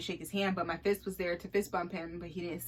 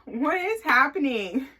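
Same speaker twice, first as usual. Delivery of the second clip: higher, louder and slower, drawn-out disbelief; what is happening stretched in disbelief; incredulous; 6 semitones higher, 8 dB louder, slower than usual. Retold or imitated. Repeats, more incredulous.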